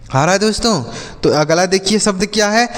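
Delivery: usual speaking voice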